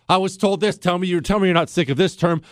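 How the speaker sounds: usual speaking voice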